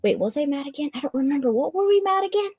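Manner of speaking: high, puzzled questioning; acted confusion. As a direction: act out losing track, pitch climbing. Acts out forgetting her anger.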